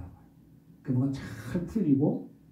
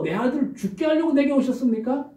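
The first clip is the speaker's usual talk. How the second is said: accusing question; 10 semitones higher than usual. Acted. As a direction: demand why he came. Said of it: "따집니다". (아들 잃은 여인의 높은 따짐)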